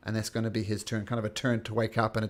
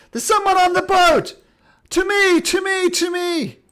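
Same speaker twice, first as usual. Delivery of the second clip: alarm shout; 13 dB louder than usual. Acted. Guard alerts the ship again.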